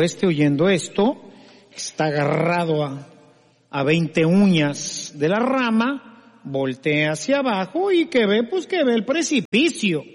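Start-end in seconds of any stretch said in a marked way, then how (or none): none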